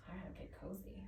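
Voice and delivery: whispered aside to herself; murmured unvoiced aside. Real, not acted. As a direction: breathe it under your breath. Aside while kneeling with dog.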